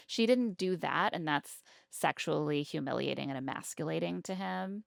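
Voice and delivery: usual speaking voice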